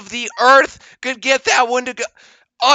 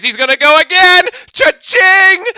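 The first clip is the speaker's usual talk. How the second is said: rising hype, louder and higher; excited call; 10 semitones higher, 6 dB louder than usual. Real, not acted. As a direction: sell the big three like a play-by-play man.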